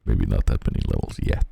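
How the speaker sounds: low mock voice. GM repeats in low voice.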